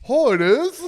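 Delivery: squeaky high, loud surprise; mock surprised wife. Mimicking partner surprised to hear it is Cowboy. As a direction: play her clueless surprise. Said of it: "But it's like".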